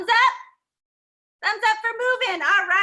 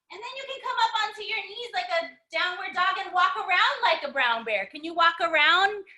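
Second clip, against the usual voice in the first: usual speaking voice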